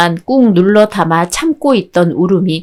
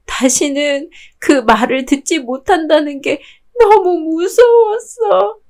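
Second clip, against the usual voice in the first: tearful; 3 semitones higher than usual. Acted. Girl tells friends she misses mom, tearful. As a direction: voice rising and breaking toward tears, slowing at the end. Friend confesses missing her mother.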